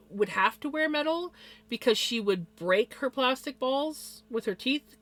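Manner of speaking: ordinary talk, nothing marked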